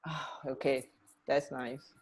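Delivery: normal talking voice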